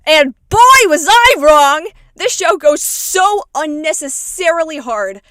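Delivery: loud, slow, drawn-out emphasis; hyped exclaiming. Real, not acted. Fan gushing to viewers about a surprisingly intense show, hyped. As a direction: belt it out, stretching the big words.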